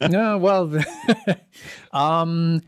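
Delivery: high laughing start; real laughter. Real, not acted. Guest laughing at the host's question, high and amused. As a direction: laugh into the answer.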